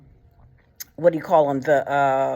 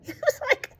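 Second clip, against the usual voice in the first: laughing; 9 semitones higher than usual. Real, not acted.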